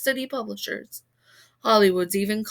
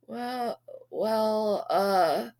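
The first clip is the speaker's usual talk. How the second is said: stammering; much slower than usual. A narrator's reading. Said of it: "I stammered".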